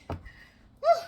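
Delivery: high, loud exhale of relief; loud relieved whew. Real, not acted. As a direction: blow it out in relief. Relief after struggle.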